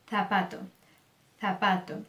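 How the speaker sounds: zapato starting on a lisped "th"; tongue between the teeth to begin zapato; Castilian Z. Shown as a demonstration. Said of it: "a língua entre os dentes". (Z de língua presa em zapato)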